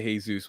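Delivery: "Jesus" said plainly as hay-zoos; Hay-zoos pronunciation. Shown as a demonstration. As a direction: say "Jesus" with an H, long ay, ending zoos. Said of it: "I say Jesus".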